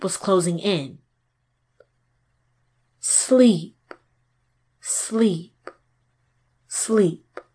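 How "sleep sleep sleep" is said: soothing, fading sleep chant; hypnotic repetition. Acted. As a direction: lull her down, fading. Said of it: "repeated over and over".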